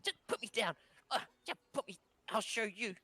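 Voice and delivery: high, strained little voice; struggling kid. Acted. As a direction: protest in a high voice while struggling.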